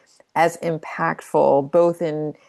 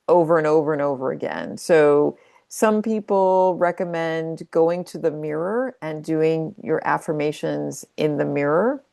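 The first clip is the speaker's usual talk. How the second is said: ordinary delivery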